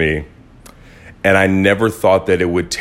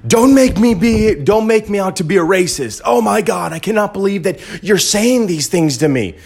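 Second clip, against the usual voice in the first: much higher hysterical mimic of the friend; imitating the former friend yelling in all caps; mock shrill yell; 11 semitones higher than usual. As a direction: screech it like an offended caps-lock message. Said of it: "came back at me in all caps, yelling at me".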